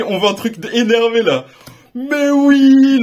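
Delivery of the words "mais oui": louder, higher cry of delight; a real burst of joy; excited cheer. Real, not acted. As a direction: cheer the pull out loud. Rare card pulled.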